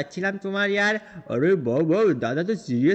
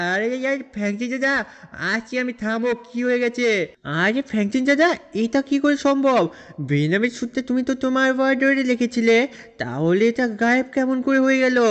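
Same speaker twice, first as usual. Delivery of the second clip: put-on much higher, urgent childish voice; a little boy in disbelief, pitched up high; shocked kid voice; 5 semitones higher than usual. Shinchan asking Franklin how the suit vanished, high shocked kid voice.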